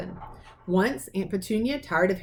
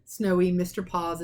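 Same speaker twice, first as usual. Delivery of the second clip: ordinary delivery, nothing marked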